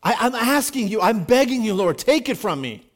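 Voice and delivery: loud, high, anguished begging cry; Paul crying out to God, desperate loud plea; desperate pleading. Acted. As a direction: beg God loudly to take the thorn away. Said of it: "he is desperately pleading".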